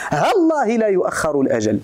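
"ها الله" fused into hallaahi; short ha, no glottal stop before "الله"; short vowel, no hamza. Shown as a demonstration. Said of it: "بحذف الألف والهمزة". («ها الله» بحذف الألف والهمزة)

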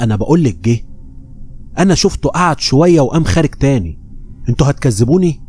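usual speaking voice

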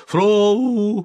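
high, loud drawn-out nonsense cry; sleeper's shout. A narrator's reading. Sleeper suddenly understands everything, high startled shout. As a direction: yell it as if suddenly understanding in a dream. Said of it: "крикнул спящий".

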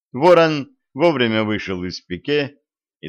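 ordinary delivery, nothing marked

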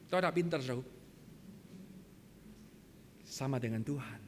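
ordinary talk, nothing marked

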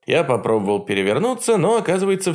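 ordinary delivery, nothing marked

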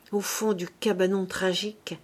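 usual speaking voice

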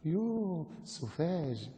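soft, drawn-out affected scorn; mock disgust. Preacher mimics westernised scoffer at the devout, mock disgust. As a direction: draw it out softly with affected distaste.

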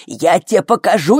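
loud maternal threat; angry yelling. A narrator's reading. Flo threatening her screaming daughter, angry yelling. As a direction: snap it back loudly in fury. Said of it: "завопила Фло".

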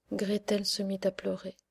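plain reading voice

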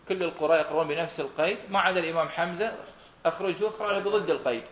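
ordinary talk, nothing marked

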